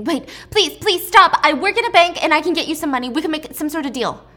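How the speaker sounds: high, loud desperate begging; pleading scream. Retold or imitated. Captive sister screams and begs the guard with the knife. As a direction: beg for your life, voice rising. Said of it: "she starts screaming".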